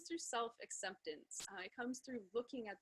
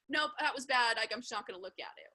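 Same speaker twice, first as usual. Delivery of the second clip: dismissive refusal; 11 dB louder than usual. Retold or imitated. Mock dismissing one's own behaviour.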